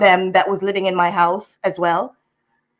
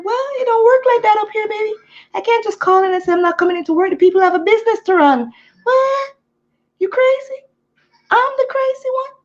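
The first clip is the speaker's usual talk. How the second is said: high, disbelieving outburst; what, are you serious; incredulous; 10 semitones higher than usual. Real, not acted. A daughter ranting in disbelief at her family's demands.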